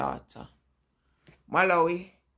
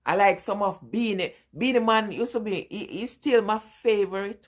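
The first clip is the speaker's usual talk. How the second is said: normal talking voice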